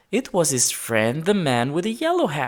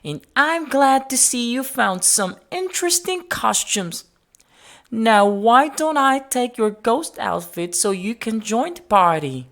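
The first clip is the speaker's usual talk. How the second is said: higher, kindly lady voice; Mrs Gray's voice; 8 semitones higher than usual. A narrator's reading. Mrs Gray offering George dress-up clothes, in a kindly voice. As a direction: voice her as a gentle older lady. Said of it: "said Missus Gray".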